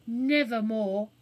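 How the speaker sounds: slow, loud, drawn-out; burglar's solemn vow. Acted. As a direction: swear it solemnly. Burglar vows.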